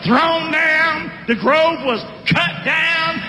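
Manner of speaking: high, slow preaching chant for the church to echo; slow call-out. Shown as a demonstration. Preacher leading the church, slow high call-and-response chant. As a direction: lay each phrase out slowly so they can repeat. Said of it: "Say that with me".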